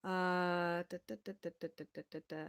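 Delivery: usual speaking voice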